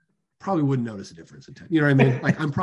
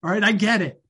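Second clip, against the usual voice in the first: mock party yell; 7 semitones higher than usual. Father at a party mock-yelling that he's failing. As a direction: blurt it out to the whole party as a joke. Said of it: "you yell it in the party".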